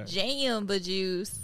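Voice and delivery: slow, drawn-out "Jamba juice" with a country twang; country-accent pronunciation. Retold or imitated. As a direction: stretch the first vowel of "Jamba juice" like a country speaker. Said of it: "like, a country accent would come out".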